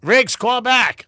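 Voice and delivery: loud, slow call. Real, not acted. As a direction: call out to him slowly. Calling to lost caller.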